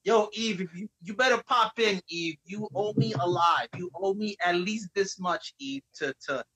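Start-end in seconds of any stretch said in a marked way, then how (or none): none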